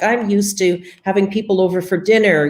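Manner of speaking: ordinary talk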